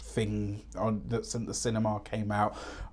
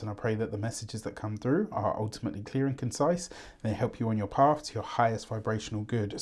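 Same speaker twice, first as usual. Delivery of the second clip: softer, hushed prayer voice for blessing the cards; 3 semitones lower, 5 dB quieter than usual. Acted. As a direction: bless the deck gently, like a quiet prayer. Blesses the tarot decks.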